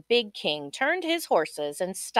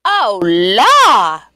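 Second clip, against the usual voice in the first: much louder distressed cry; mama crying out; 13 dB louder than usual. Acted. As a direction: wail it out in alarm. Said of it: "cried the mama".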